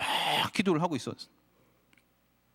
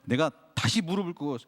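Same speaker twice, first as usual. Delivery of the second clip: usual speaking voice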